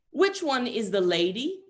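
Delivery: put-on high, slow, puzzled kid's question; imitated child, slightly loud and drawn out; six-year-old boy. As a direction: ask it like a confused little boy. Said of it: "six-year-old".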